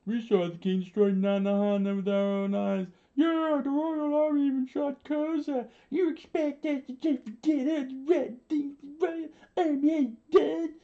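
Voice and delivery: high, strained rebel outcry; screaming rebels. A narrator's reading. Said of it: "the rebels screamed out".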